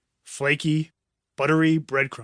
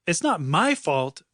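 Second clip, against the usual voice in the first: ordinary delivery, nothing marked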